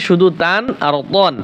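ordinary delivery

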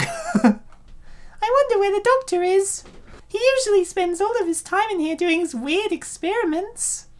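put-on high girlish assistant voice; female assistant voice. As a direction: lift the voice high for the cute assistant.

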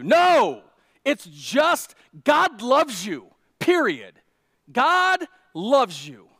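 forceful proclamation. Real, not acted. Preacher to congregation, loud slow emphatic declaration. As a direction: shout it out, full preaching force.

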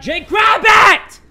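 drawn-out, high, very loud shout; full-voiced, stretched-out holler; frantic yell. Real, not acted. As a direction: bellow the order at yourself. Yelling at himself to grab.

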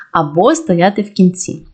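ordinary delivery

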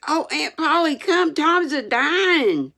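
high, urgent call for help; frantic boy summoning aunt; panicked cry. A narrator's reading. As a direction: cry out the terrible news. Sid runs for help.

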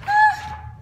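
high surprised ah; surprised gasp. Acted. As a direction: gasp in delight. Reader gasps at the picture.

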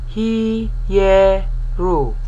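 he-ye-ru split into clear syllables; three syllables, one by one; Hebraistic syllables. Shown as a demonstration. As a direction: sound out each syllable separately. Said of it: "like here".

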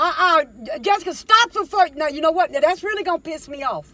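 high, loud scolding; furious mom scolding; angry warning. Real, not acted. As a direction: warn her she's crossing the line. Mom warning daughter over cigarettes.

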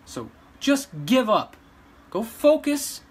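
loud, high, slow scolding; a loud, scornful order to back off; angry dismissal. Real, not acted. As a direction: tell them off hard. Telling critics to give up.

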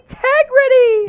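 very high, drawn-out mimicry; Chuck Cooperstein. Host mimicking a Mavs fan, high drawn-out impression. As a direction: cry it out in a sustained high impression voice. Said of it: "in my best Coop voice".

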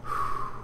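soft, audible calming exhale; slow sigh of self-control; deep breath. Real, not acted. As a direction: breathe out to settle yourself. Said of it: "deep breaths".

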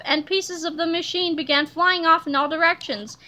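ordinary delivery

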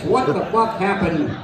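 ordinary talk

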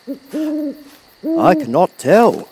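slow, high-pitched, measured answer; Tin Woodman. A narrator's reading.

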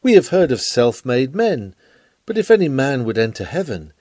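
ordinary talk

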